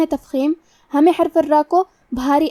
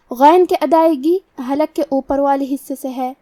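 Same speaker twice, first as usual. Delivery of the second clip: ordinary delivery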